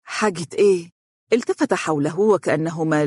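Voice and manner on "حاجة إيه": mildly curious question; a touch of eager curiosity; interested. Acted. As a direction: ask with keen interest. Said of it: "والدته باهتمام".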